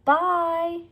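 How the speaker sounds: high, loud farewell call. A narrator's reading. Annie calling goodbye to the departing dinosaur. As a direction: call goodbye across the hill.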